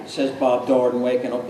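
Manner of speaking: ordinary delivery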